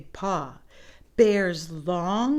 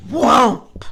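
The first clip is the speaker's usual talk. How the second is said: whomp; 3 semitones higher, 14 dB louder than usual. A narrator's reading. Bear's paw slams down.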